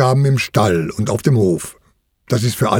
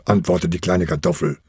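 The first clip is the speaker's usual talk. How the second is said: usual speaking voice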